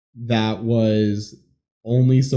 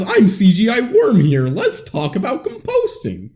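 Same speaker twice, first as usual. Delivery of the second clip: cartoon worm voice; 8 semitones higher than usual.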